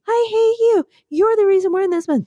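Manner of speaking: the first shoe fights for its turn; first shoe, bickering. Pretend. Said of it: "I'm a shoe".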